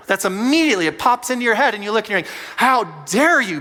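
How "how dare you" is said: drawn-out, louder how dare you; slow indignant outrage. Retold or imitated. Pastor voicing our hurt reaction to a spouse, slow indignation. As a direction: let the offense land slowly. Inner outburst when fuse lit.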